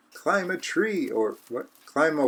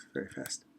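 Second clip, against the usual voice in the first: soft unvoiced whisper; 14 semitones lower, 15 dB quieter, much faster than usual. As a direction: breathe it as a secret. Whispered lines.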